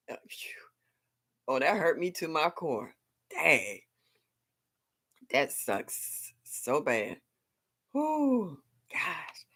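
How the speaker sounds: breathy whispered sighs; whisper, stunned by the bad news; soft sighing sympathy; hushed dismay. Real, not acted. Reacting to friend's loss.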